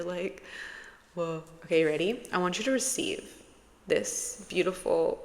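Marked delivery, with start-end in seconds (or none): none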